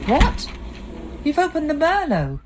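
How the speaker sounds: can't believe what she sees; shocked outburst. Acted. Wife finding the wedding wine opened, shocked.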